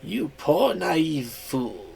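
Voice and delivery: very slow, soft menacing sneer; sinister mouse. Acted. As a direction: savor the betrayal. Mouse reveals trick.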